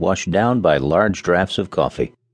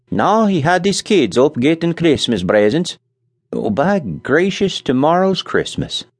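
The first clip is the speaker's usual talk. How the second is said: put-on Scandinavian accent; 8 semitones higher than usual. A narrator's reading. Nels voiced, accent.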